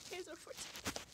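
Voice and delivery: breathless high laugh through the words; airy high laughing reread; wheezy laugh. Real, not acted. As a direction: giggle it out without voice.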